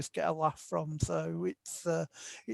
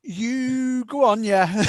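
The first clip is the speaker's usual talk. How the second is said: slow and laughing, higher and louder; 5 semitones higher, 6 dB louder, much slower than usual. Real, not acted. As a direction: laugh while giving permission. Laughing at the audiobook question.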